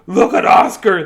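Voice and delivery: loud jeering shout; loud teasing dogs; mocking cry. A narrator's reading. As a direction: call it out to the whole pack. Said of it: "they cried".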